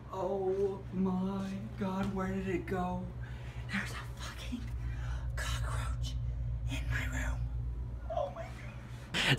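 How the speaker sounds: hushed, breathless whisper; whispering in fear of the bug. Real, not acted. Played Snapchat footage, whispering.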